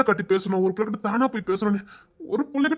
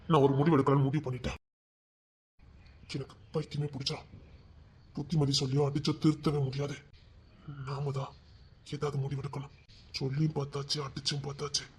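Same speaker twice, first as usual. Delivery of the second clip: quiet grim resolve; 6 semitones lower, 9 dB quieter than usual. Acted. Exhausted resolve after rage.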